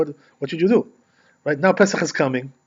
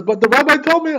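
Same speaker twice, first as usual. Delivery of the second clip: much higher, insistent objection; upset lady; 12 semitones higher, 4 dB louder than usual. Retold or imitated. Lady insists the other rabbi said so, high pleading. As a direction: push back in a high pleading voice.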